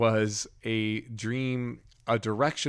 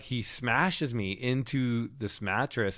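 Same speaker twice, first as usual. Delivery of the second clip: ordinary talk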